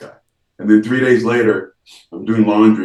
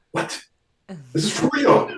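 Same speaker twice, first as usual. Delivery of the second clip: stunned disbelief; 12 semitones higher than usual. Retold or imitated. Disbelief on the phone.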